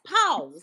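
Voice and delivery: "Pause" said with an S at the end; defensive demo of "Pause" ending in S; final S insisted on. Shown as a demonstration. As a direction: prove the S in "Pause". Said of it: "That's a S".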